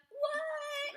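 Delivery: high, squeaky mock what; mock surprised what. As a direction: play innocent, high. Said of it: "she'd be like".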